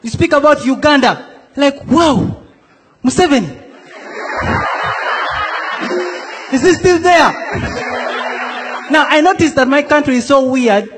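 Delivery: normal talking voice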